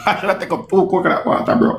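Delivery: said through a laugh. Real, not acted. Reacting to a clever bar, laughing. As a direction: laugh while getting the words out.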